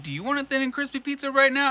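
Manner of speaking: a light mock sweet ask; teasing ask. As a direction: tempt dad with pizza. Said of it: "text my dad and be like".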